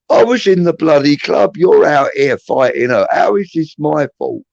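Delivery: loud, high-pitched protest, re-enacted; indignant comeback. Blamed man answers the fighting girl back, indignant. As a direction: argue back in outraged disbelief, starting high.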